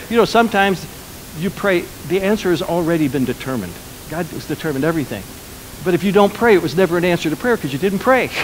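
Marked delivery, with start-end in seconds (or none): none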